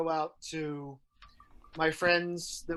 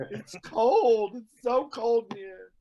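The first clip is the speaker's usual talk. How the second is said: higher, drawn-out pretend moan; mock complaint; 5 semitones higher, 6 dB louder, slower than usual. Joking about exile to the couch, mock complaint. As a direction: play up being left out in the cold. Playing banishment to community room couch.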